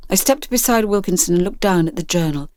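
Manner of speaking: ordinary delivery, nothing marked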